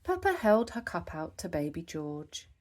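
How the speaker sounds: ordinary delivery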